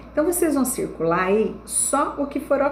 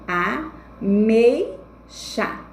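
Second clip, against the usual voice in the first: a, mei, chá with clear gaps, mei stronger; teacher's slow syllable split of a, mei, chá; separating syllables; much slower than usual. Shown as a demonstration. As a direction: break the word into a... MEI... chá. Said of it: "Quando você vai separar a palavrinha".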